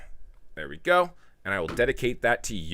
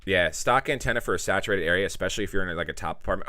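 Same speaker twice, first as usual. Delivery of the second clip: normal talking voice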